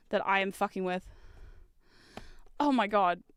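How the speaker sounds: ordinary delivery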